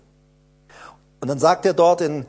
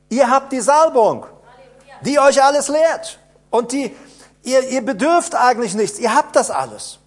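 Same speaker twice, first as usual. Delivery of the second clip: higher and louder, driving preacher voice; preaching at full force; 6 semitones higher, 5 dB louder than usual. Acted. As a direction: hammer it home to the congregation. Preaching climax.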